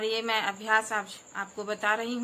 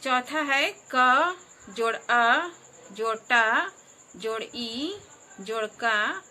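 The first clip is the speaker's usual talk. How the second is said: syllables joined one by one, slow and emphatic; slow spelling out; slower than usual. Shown as a demonstration. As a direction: build the word aloud piece by piece, very slowly. Spelling syllables aloud slowly.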